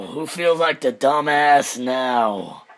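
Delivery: drawn-out smug repeat; slow gloating taunt. Real, not acted. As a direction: savour each word slowly.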